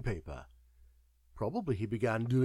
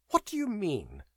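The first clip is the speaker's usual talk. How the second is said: March's voice suddenly changed, higher and sharper; a sudden shift in tone, higher pitched; altered tone; 7 semitones higher than usual. A narrator's reading. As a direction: ask it in a changed, startled voice. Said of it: "asked March in an altered accent".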